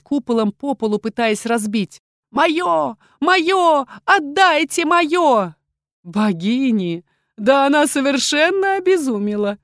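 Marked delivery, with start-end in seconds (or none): 2.3-5.5 high, shrill possessive cries; manic screaming. Acted. Maddened woman screams for flower. 6.0-9.6 babbling baron ghost. A narrator's reading. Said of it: "пролепетал призрак барона".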